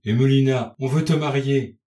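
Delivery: high-pitched, sorrowful appeal; tearful pleading. A narrator's reading. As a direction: beg your daughter as if close to tears. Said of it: "d'une voix pleine de larmes".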